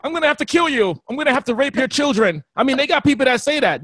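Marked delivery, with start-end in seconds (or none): 0.0-2.4 high, loud mock outrage; shrieking mock zealot vegan; hater vegan. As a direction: ham up a furious zealot. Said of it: "one of those haters like".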